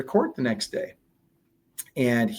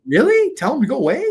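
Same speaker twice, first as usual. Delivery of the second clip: high, loud disbelieving question; incredulous; 12 semitones higher, 6 dB louder than usual. Retold or imitated. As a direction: echo the advice back in disbelief. Retelling disbelief at attorney's advice.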